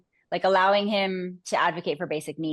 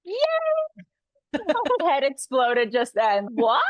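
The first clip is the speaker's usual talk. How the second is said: loud delighted squeal; excited; 7 semitones higher, 3 dB louder than usual. Real, not acted. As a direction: react with thrilled disbelief. Excited reaction to award news.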